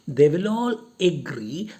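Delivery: ordinary talk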